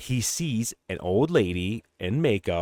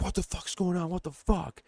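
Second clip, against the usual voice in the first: high, softer exasperated outburst; mock pissed-off; 10 semitones higher, 4 dB quieter than usual. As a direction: imitate a fed-up man, pitched way up. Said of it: "he's pissed".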